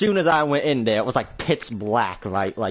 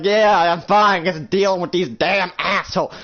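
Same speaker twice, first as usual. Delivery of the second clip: raised voice over gunfire; loud, higher, harried reassurance; exasperated shout; 5 semitones higher, 4 dB louder than usual. Acted. Woman on phone mid-firefight, exasperated.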